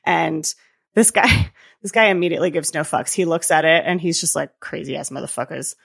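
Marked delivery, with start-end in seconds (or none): 4.6-5.8 low, very soft imitated mutter; mock dismissive aside. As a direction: toss it off under the breath. Guard's dismissive aside, retold.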